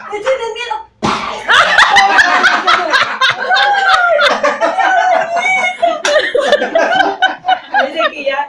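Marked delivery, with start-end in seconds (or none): 0.1-0.8 high loud mock shout; hammed-up drunk yell; drunken challenge. 0.8-7.8 long loud high laughter; helpless real cackle; laughing fit. Real, not acted. Teller cracks up at punchline.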